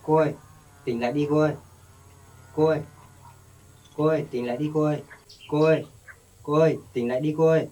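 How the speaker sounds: loud urgent calls to wake. Acted. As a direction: shout for her to come round. Calling her to wake again.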